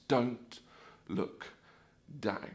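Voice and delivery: one soft, low word at a time; hushed, with long pauses between words; measured warning. Acted. Sign at the drop advising riders, measured warning. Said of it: "says these wonderful words of advice".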